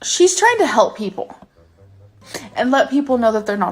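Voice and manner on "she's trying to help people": high-pitched, close to crying, halting between phrases; on the verge of tears. Real, not acted. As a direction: fight back tears as you explain. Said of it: "I'm gonna start crying".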